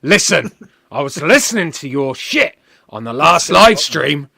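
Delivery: loud, hammed-up cockney "Oi"; mock cockney wrestler laying into him. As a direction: call out like a brash cockney geezer. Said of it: "He's a cockney".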